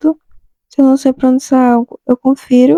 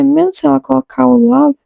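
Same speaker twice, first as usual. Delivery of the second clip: ordinary delivery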